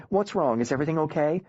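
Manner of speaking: a mom startled by a late call; worried mom. Acted. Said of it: "my mom answered with".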